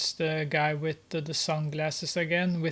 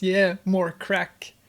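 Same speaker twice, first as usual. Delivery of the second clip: eager Grace; 3 semitones higher, 5 dB louder, slower than usual. Acted. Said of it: "Grace is just".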